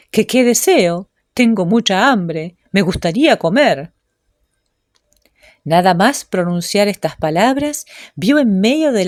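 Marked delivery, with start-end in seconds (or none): none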